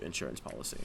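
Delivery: very low, hushed deadpan; Jake from State Farm. Acted. Costumed guest asking for candy, low character voice. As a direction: keep it down in a low, polite monotone. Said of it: "that's how I talked the whole time".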